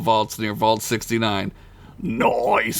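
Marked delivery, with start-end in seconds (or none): 2.0-2.8 goblin voice. Acted. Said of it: "Slit said".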